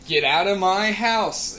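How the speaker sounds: high, loud, slow yell; furious shout. Retold or imitated. Brother charging from his room at the intruder, furious shout. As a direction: bellow it slowly to drive him out.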